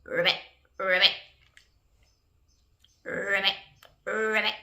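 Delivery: rolled, croaky little ribbits; short trilled frog croaks. Acted. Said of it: "It says ribbit".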